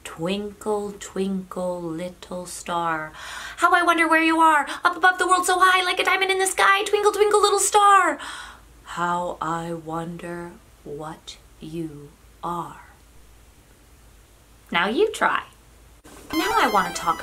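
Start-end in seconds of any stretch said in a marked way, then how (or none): 0.0-3.1 slow, measured nursery rhyme. Shown as a demonstration. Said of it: "practice speaking slow". 3.6-8.2 high, loud, racing recital; very fast. Shown as a demonstration. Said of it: "and fast". 8.8-12.8 very slow, low and soft. Shown as a demonstration. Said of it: "practice speaking slow".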